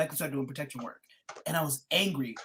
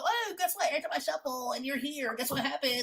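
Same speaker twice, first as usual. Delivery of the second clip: high, quick teasing spirit voice; mock a spirit taunting the listener; imitating the deity; 12 semitones higher than usual. Spirit teasing the host each shuffle, high playful voice. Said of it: "he's like".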